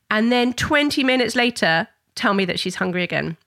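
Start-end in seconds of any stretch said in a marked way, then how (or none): none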